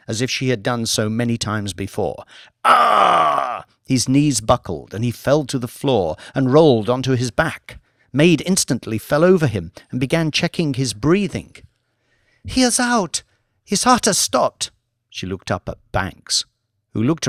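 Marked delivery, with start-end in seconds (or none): 2.6-3.7 sharp high cry of pain; pained yelp. Acted. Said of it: "yelped in agony". 12.4-14.7 much higher, frantic alarm; panicked maid. Acted. Maid panicking over collapsed Lord.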